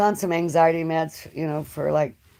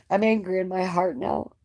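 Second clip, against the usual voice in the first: usual speaking voice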